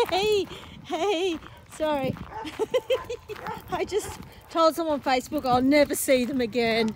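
usual speaking voice

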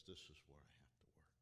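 fast, hushed self-admission; talking to yourself in the mirror, whispered; private whisper. Retold or imitated.

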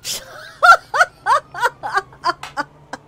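rire fort et aigu; éclate d'un rire qui crie; fou rire. Real, not acted. Rit après la scène. (gros fou rire)

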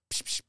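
two short hissed air puffs; quick "psh, psh" of escaping air; imitated pump noise. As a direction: mimic a tyre pump with your mouth. Mimes pumping sound to the mechanic.

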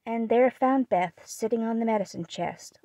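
usual speaking voice

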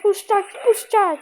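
excited let-go cry; excited urging. Real, not acted. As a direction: egg the game on eagerly. Urging a move in the game.

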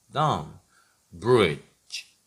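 normal talking voice

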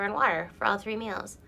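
ordinary talk

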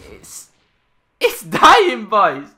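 loud, high-pitched outburst; loud exclaim. Real, not acted. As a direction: shout it out gleefully. Ranting at Activision's decline.

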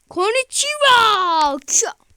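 loud, slow stretched cry; mock anime shout. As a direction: ham up the anime call. Abartılı Japonca taklit. (abartılı, uzatılmış anime bağırışı)

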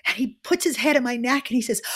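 ordinary talk, nothing marked

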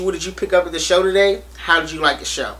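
usual speaking voice

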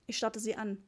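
ordinary delivery

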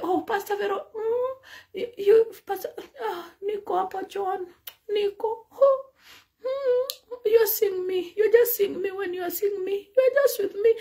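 high, soft hammed-up sobbing; mock wailing mourners. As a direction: wail over her like a mourner. Mourners wailing over pastor.

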